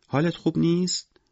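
put-on high-pitched child asking a pet; small, concerned child voice; little girl. A narrator's reading. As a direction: ask sweetly like a four-year-old. Little girl asks the penguin.

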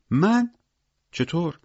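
very high-pitched, startled questions; startled disbelief. A narrator's reading. Victor reacts to being blamed by Misha, startled disbelief. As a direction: shoot the pitch right up, taken aback.

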